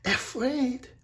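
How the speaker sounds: high-pitched disbelief; astonished. A narrator's reading. Mirette hears Bellini is afraid, astonished. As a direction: echo the word high, in astonishment. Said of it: "was astonished".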